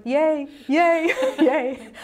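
high, loud, laughing cheer; inner cheering. Retold or imitated. Patient secretly cheering the doctor's offer, gleeful. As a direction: celebrate gleefully, breaking into laughter. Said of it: "inside I was going".

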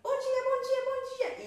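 very high, loud, squeaky ladybug. A narrator's reading. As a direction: rattle it off high and hurried. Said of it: "A Dona Joaninha falava assim".

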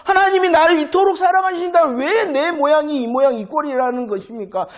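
high, pleading complaint; anguished protest. Acted. People see their own misery and challenge God, high-pitched protest. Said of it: "자신의 모양과 자신의 꼬라지를 보니까".

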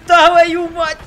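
high, loud outraged shout; shocked yell. Real, not acted. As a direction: scream it in disbelief. Shock at a comeback.

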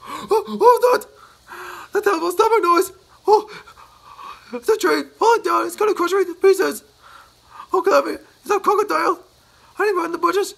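very high, loud panicked babble; terrified. Acted. Mister Jelly alone in the woods, shrill with terror. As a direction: spiral into panic at every noise. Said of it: "jumped twice as high in terror".

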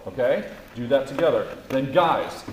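usual speaking voice

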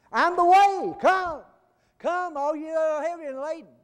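very high, very loud calling out; preached shout. Acted. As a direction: cry out the invitation with all you have. Invitation shouted at full force.

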